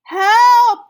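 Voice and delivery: very high, loud panicked shout; cry for help. Acted. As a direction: scream for help in the flood. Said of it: "the animals cried".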